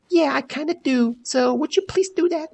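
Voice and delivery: high, mimicked insistence; imitated roommate.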